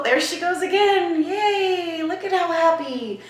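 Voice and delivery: thrilled high squeal; excited. Real, not acted. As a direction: gush with joy at the signal. Delighted as the cat ball lights.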